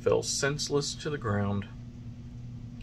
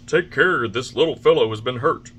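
louder call across the water; 4 semitones higher, 9 dB louder than usual. A narrator's reading. Officers calling to passing fishermen, loud. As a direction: call out to the boat.

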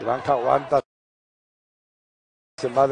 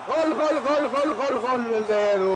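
breakneck goal commentary; 4 semitones higher, 5 dB quieter, slower than usual. Real, not acted. Repeated goal cry.

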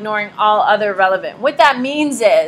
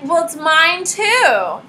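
higher, slow, mock-offended comeback; mock indignant; 6 semitones higher, 4 dB louder, much slower than usual. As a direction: argue back like a child. Adult arguing with kid.

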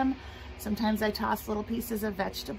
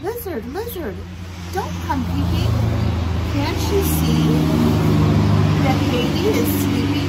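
higher, louder sing-song voice; pleading lullaby mama; 5 semitones higher, 9 dB louder than usual. Acted. Mama asking lizard not to wake baby, sing-song. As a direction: chant the plea with a strong lilt.

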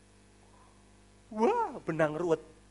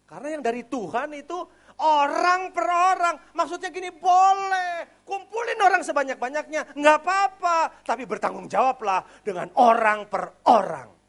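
louder, higher preaching push; fiery preaching; 11 semitones higher, 9 dB louder than usual. Acted. Preacher hammering his point to the congregation, loud and rising. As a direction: drive each point home to the church.

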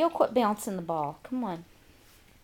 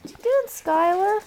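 high pet talk; 9 semitones higher, 6 dB louder than usual. Real, not acted. Mother coos at shy dog.

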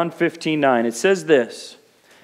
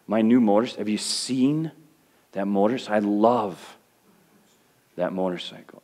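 lower, softer, cooing over a motorcycle; mock cooing; 6 semitones lower, 5 dB quieter than usual. Shown as a demonstration. Pastor mocking shallow love, soft low crooning. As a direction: croon it like a besotted owner. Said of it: "we take this word love".

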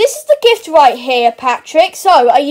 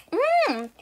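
babbly cartoon meow sounds; mock Gary mewing; snail noises. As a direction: mew like a pet snail. Child voicing the snail Gary.

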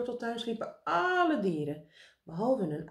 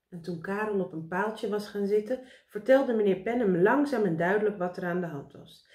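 ordinary delivery

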